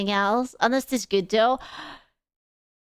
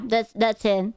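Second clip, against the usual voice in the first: ordinary delivery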